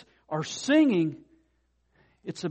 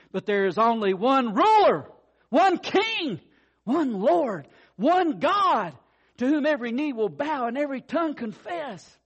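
higher, louder, emphatic list; ringing proclamation; 5 semitones higher, 3 dB louder than usual. Real, not acted. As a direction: ring out each title. Preaching crescendo.

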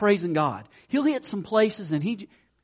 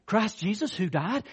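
usual speaking voice

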